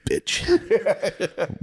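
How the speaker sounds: a spiteful little jab, hammed-up; mock muttered insult. Partner putting a cup away, snide mock insult.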